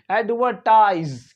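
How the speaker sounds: एडवर्टाइज with the R sounded; American pronunciation. Shown as a demonstration. Teacher contrasts American English, R pronounced. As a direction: say a curled R before the T in एडवर्टाइज. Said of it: "American English में लेकिन थोड़ा सा अलग".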